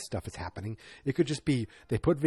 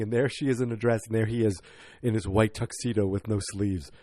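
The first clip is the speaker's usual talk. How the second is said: ordinary talk, nothing marked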